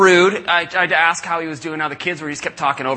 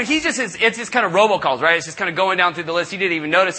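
ordinary talk, nothing marked

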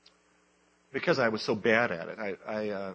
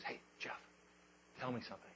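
hushed aside; 17 dB quieter, much faster than usual. Retold or imitated. Retold friend leaning in quietly.

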